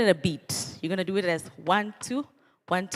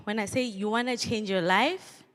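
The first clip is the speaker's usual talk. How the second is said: usual speaking voice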